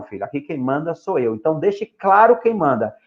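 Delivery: normal talking voice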